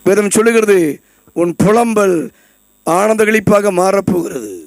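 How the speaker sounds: ordinary delivery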